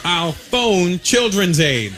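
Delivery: slow, deliberate repeat of the threat; slow bluff. Retold or imitated. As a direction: drag out each word as a dare. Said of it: "call your bluff".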